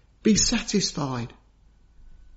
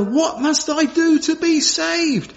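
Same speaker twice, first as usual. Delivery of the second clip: higher, slow, a touch of dread; mild terrified cry; 9 semitones higher than usual. Retold or imitated. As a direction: cry out the question with understated fear. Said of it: "crying out with terror".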